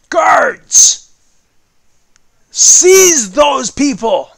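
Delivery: booming warrior king. Acted. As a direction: roar orders at the guards. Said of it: "the royal voice".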